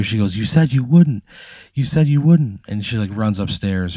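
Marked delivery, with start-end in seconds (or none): 0.4-2.6 higher, mock-screamed accusation, held back; retold screaming reproach. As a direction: imitate an upset wife raising her voice. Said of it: "she just starts screaming at you".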